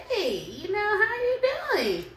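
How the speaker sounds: high, sing-song fake "how you doing"; sweet on the surface, knowing better; imitating someone playing it off nicely; fake friendly greeting. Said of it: "playing it off like".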